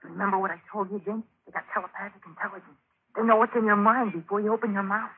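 low-volume, confidential aside; hushed warning. Acted. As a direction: warn your friend under your breath. Secret warning to friend before captors.

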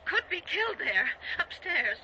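high-pitched, urgent warning; fearful warning. Acted. Wife warning her husband he may die, fearful. Fears husband will be killed.

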